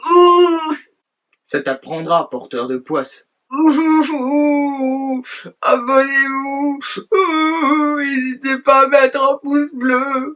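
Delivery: high, loud jeering hoots; loud booing. Acted. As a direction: boo him loudly. Booing Michel, then outro. (huées moqueuses)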